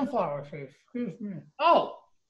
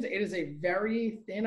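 ordinary delivery

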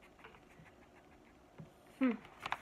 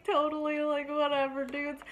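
lazy surfer-dude dog, a little higher; voicing the dog as a surfer; 5 semitones higher than usual. Dog brushing off the viewers, bored surfer-dude voice. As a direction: shrug it off like a bored teen dude. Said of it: "He's like".

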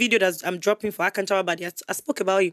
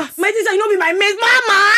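mock hailing; 10 semitones higher, 7 dB louder than usual. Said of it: "Stop calling me mama".

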